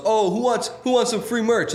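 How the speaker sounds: put-on high Italian-accented merch giveaway; hammed-up Italian hawker; Italian merch pitch. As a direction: hawk merch like a cheesy Italian emcee. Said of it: "he goes".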